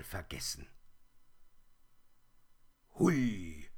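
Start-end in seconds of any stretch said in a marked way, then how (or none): 2.9-3.7 freudig laut gerufenes Hui; lauter Ruf. Acted. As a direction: ruf es laut und fröhlich in die Blätter. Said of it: "rief er laut". (der kleine Bär ruft laut ins Laub)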